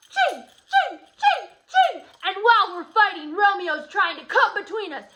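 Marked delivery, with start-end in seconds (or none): 0.1-2.1 high, loud sword clang noises; mock swordfight sounds; sword fight sound effects. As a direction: act out the swordfight with rising clangs. Teacher acting sword fight sounds.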